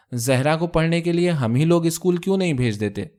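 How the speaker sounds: usual speaking voice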